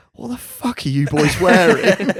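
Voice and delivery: slow, loud mock disbelief; mock incredulous. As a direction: jeer it like an appalled rival player. Footballer's incredulous jibe, retold.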